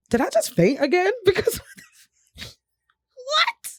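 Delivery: very high, incredulous squeak trailing off; bewildered disbelief. Retold or imitated. Woman to herself after fainting in bathroom, high bewildered disbelief. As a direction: act out baffled disbelief, pitch shooting up. Acts out waking bewildered on floor.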